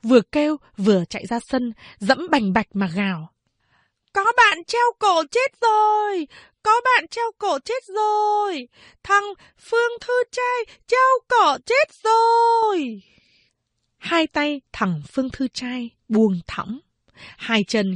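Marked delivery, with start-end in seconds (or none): 4.1-13.0 much higher, frantic alarmed cry; panicked shouting. Acted. Panicked pupils running out shouting that a classmate hanged himself. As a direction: scream the news as you run in terror. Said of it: "hốt hoảng kêu lên".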